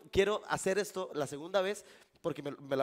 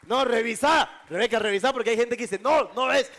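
raised, emphatic call to the volunteer; loud urging; 7 semitones higher, 13 dB louder than usual. Real, not acted. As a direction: push her loudly to check the card. Magician urges volunteer to check.

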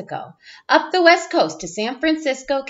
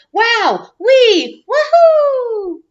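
each cry higher and louder, a high woohoo; thrilled cheers; 11 semitones higher, 5 dB louder, much slower than usual. Acted. Child reading to class, whooping as the truck races. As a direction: ride the speed with rising whoops. Going really fast.

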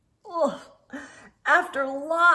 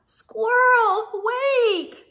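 calling out; 9 semitones higher, much slower than usual. Acted. Friends calling after Squirrel as she races off. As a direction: call to someone racing away. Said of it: "her friends called".

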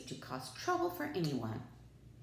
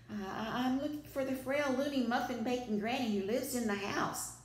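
high, nervous stammer; 7 semitones higher than usual. A narrator's reading. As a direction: stutter it out, scared. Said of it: "gasped and stammered".

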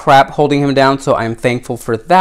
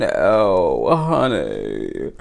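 sad sympathy; 7 semitones lower, 6 dB quieter, much slower than usual. Real, not acted. Finds a stain on the doll.

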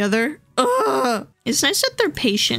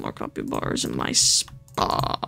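soft whispered confession, faster toward the end; mock greedy whisper; 6 dB quieter than usual. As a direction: whisper it like a playful little secret. Joking greed for money.

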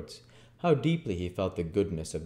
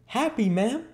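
put-on very high, eager boy; crying out as Oliver; 5 dB louder than usual. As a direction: exclaim in a little boy's voice, pitched right up. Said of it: "cried Oliver".

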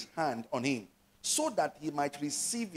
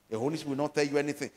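1 fast repeat; much faster than usual. Shown as a demonstration. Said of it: "You know, I told you last week".

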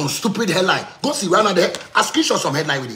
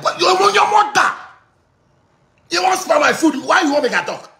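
high, indignant raised voice; angry outburst; 10 semitones higher than usual. Acted. Talker acting out an angry retort, high indignant outburst. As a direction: burst out in protest, pitched right up.

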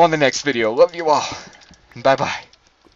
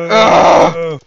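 usual speaking voice

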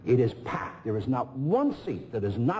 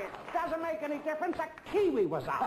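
electrician in the voice. Retold or imitated. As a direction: squeak it out like everyone else. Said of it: "I'm trying to imitate them".

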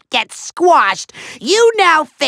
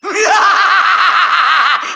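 maniacal laugh; 3 semitones higher than usual. Acted. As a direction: cackle over the loudspeaker. Villain gloating laugh.

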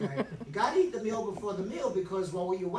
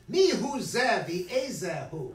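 slow, loud royal demand; a king booming out his question; 6 dB louder, slower than usual. Acted. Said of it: "And Achashverosh says".

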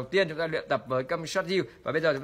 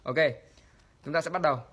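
ordinary delivery

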